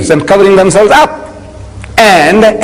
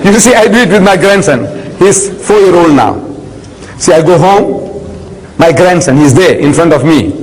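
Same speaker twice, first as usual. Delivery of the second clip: usual speaking voice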